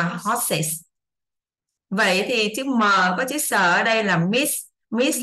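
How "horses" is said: "horses" with a separate sis ending; two-syllable plural. Shown as a demonstration. Teacher modelling many horses, "horses" in two syllables. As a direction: sound out both syllables of "horses". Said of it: "thì chúng ta sẽ đọc là".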